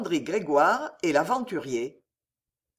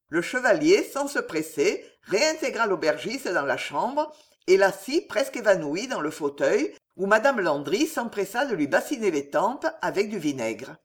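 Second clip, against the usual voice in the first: plain reading voice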